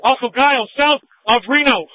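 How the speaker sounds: loud, strained radio shout; urgent shouting. Real, not acted. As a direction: shout the directions out. Urgent radio call under fire.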